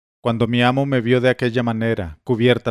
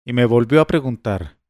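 usual speaking voice

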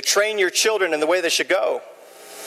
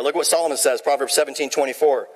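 usual speaking voice

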